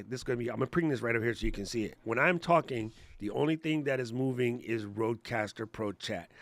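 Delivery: ordinary delivery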